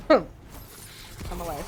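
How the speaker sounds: slow, slightly choked after a coughing fit; recovering from a cough. Real, not acted.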